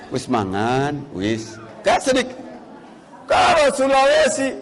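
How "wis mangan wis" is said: low, slow, gentle Javanese; soft Javanese. Retold or imitated. Preacher shows refined Javanese politeness, low and slow. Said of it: "sedikit halus juga".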